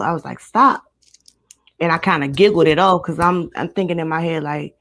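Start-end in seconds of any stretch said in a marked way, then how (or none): 0.4-0.8 higher, louder retold cry to stop; retold yell. Woman telling how she reacted to thrown liquid, retold yell. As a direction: yell it briefly, as when retelling. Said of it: "I closed my eyes and I yelled".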